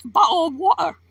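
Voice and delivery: mock British bottle of water; clipped British take on the word; British accent. Streamer joking to chat in British accent. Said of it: "I'm not making fun of the accent".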